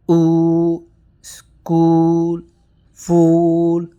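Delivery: Oo, school, fool with a held rounded oo; long oo demo. Shown as a demonstration. Teacher models long oo vowel for the class, held. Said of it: "We have to go upward".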